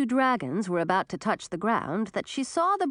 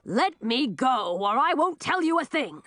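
high pinned-down shout; 6 semitones higher than usual. A narrator's reading. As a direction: yell while held down. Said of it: "he shouted at Audun".

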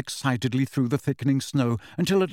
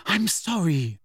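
higher, mildly gasping apology; gasped apology; 4 semitones higher, slower than usual. A narrator's reading. Hermione apologises to Hagrid after screaming, lightly gasped. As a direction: apologise with a touch of a gasp. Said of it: "Hermione gasped".

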